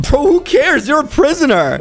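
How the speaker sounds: high-pitched, incredulous scolding; exasperated dismissal. Pretend. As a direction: brush off the complaint, pitch shooting up. Dismisses a prisoner's complaint.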